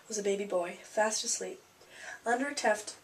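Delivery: plain reading voice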